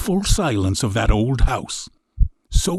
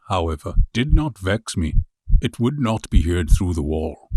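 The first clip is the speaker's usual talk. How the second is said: plain reading voice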